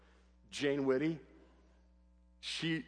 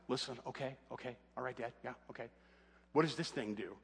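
mumbled, much softer, brushing Dad off; casual mumble; 3 semitones lower, 8 dB quieter than usual. Retold or imitated. Son brushing off his dad's driving talk, softly mumbling. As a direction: shrug it off under your breath, quietly. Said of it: "He's like".

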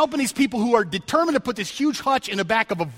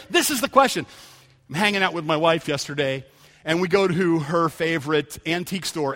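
usual speaking voice

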